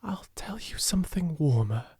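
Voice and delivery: whispered through shivers, cold to the bone; a shivering whisper. A narrator's reading. Sarah to Becky in the freezing attic, shivering whisper. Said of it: "shivered Sarah".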